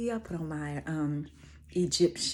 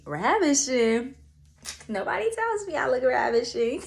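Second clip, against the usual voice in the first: amused disbelief; 6 semitones higher, 4 dB louder than usual. Real, not acted.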